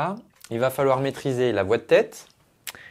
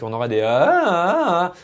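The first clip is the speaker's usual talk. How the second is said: yodel-like register breaks; 3 semitones higher than usual. Shown as a demonstration. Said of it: "des gros sauts acoustiques".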